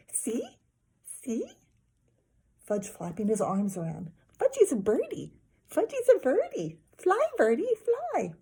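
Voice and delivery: put-on very high, gleeful toddler; excited toddler. A narrator's reading. Toddler flapping his arms on the jungle gym, gleeful and high. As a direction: squeal like a kid pretending to fly.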